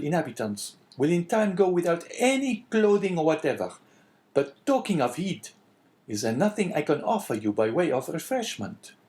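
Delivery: high eager innkeeper voice. A narrator's reading. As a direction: answer like a surprised servile host. Innkeeper voice in story.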